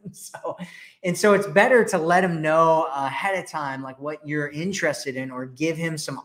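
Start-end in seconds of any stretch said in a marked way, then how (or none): none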